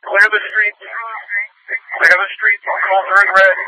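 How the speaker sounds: high, strained radio call; urgent call. Real, not acted. Officer demanding streets cleared for the transport, urgent and high. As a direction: clear the way, now.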